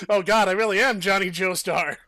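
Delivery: loud, high exclamation; mock horror. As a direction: cry out in exaggerated dismay. Exclaims at the comic realisation.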